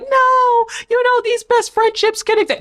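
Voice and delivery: high-pitched mimicked protest; squeaky mock defence; mock objection. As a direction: imitate naive objectors way up high. Voicing viewers who would object.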